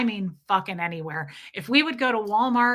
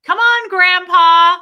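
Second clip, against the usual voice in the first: loud, high, slow call across the room; shouting out; 9 semitones higher, 15 dB louder, slower than usual. Retold or imitated. Wife shouting at husband in public to be funny. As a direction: call out so strangers hear. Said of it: "shout it out in public".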